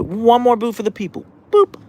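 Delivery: high, cooing pet voice. Real, not acted. Owner coos at her dog asking for a boop.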